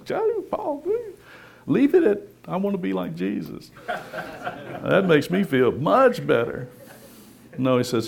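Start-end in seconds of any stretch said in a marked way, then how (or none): 0.1-3.7 mock whiny congregant. Mocking congregation voiced by preacher.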